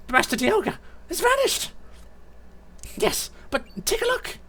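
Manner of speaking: very high-pitched minion voice; startled Sableye. Acted. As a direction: report in a squeaky creature voice. Sableye reacting to Dialga vanishing.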